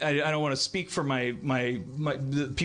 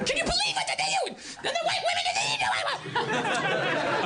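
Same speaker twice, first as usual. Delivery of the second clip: mock outraged squeal, much higher and laughing; voice flying up. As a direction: shriek it up high, half laughing, like a shocked retweeter. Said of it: "your voice keeps going up in the air".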